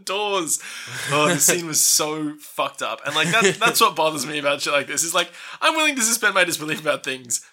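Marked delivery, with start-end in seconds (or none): none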